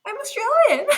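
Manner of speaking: high, loud incredulous retort; laughing indignation. Retold or imitated. Answering the street heckler, laughing indignation. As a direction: protest it loudly through a laugh.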